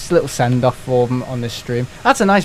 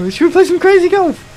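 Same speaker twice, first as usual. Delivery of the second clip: mock cheery voice; 7 dB louder than usual. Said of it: "me and my dad and Martin are like".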